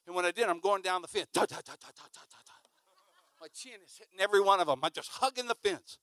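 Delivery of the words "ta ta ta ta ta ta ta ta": rapid ta-ta-ta fading away; imitated rattling. Chin bumping down the fence, rapid fading ta-ta sounds. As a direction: rattle off staccato taps, fading to a whisper.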